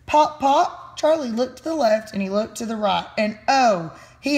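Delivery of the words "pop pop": bright popping sounds; popping sound effect. A narrator's reading. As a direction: pop it out playfully. Cocoon bursting open.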